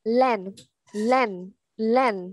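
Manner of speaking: LANE short and fast; quick reading. Shown as a demonstration. Teacher modelling the fast reading, LANE said quickly. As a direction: say LANE briskly, no drawn-out vowel. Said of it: "Đọc nhanh này".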